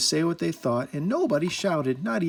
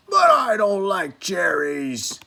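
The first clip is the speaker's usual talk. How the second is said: slow, louder, stubborn complaint; Angry refusing cherry cake, slow and louder; sulky outburst; 5 semitones higher, 6 dB louder, slower than usual. A narrator's reading. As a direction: stamp it out like a cross child. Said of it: "kicked over his chair".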